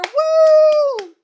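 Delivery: high, falsetto cheer. Real, not acted. Reader glad the fic is ending, high cheer. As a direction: whoop with relief.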